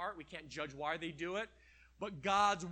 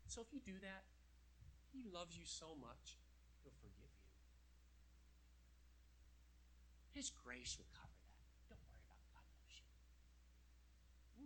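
devil whispering; 3 semitones lower, 22 dB quieter than usual. Acted. Devil whispers that grace covers it, fading whisper. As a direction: whisper the lie soothingly into their ear. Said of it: "Then he whispers to us".

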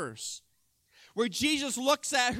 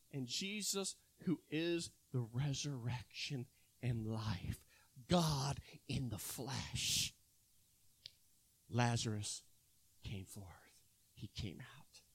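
much lower and softer, fading to a whisper; awed hush; 11 semitones lower, 12 dB quieter than usual. Real, not acted. Preacher telling the congregation Lazarus came out, hushed awe. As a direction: let the wonder drop to a whisper.

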